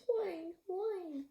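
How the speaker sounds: high whimpering pup; pup whining. Acted. Lost wolf pup meeting the girl, high whine. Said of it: "And the wolf pup says".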